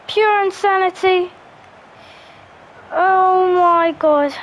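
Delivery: ordinary delivery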